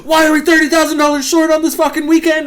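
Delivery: high, fast, loud mock yell from the boss; retold angry shout. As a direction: bellow it like a boss who just found the safe empty. Manager tears into back office.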